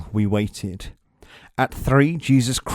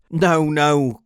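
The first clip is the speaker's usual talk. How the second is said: crying out; 4 semitones higher, 5 dB louder than usual. A narrator's reading. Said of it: "cried Peter".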